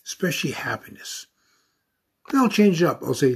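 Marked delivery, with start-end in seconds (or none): 0.1-1.3 soft breathy whisper at the end of a prayer. Shown as a demonstration. Speaker thanking the Great Spirit, whispering. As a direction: drop to a hushed whisper, savoring it.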